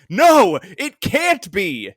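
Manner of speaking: loud, high cry, then drawn out; hammed-up horrified realisation; melodramatic disbelief. Acting out Angel's horrified realisation.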